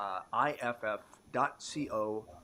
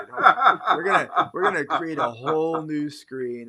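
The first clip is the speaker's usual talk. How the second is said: loud laughing; 3 semitones higher, 12 dB louder than usual. Real, not acted. Laughing loud on-air fumbling.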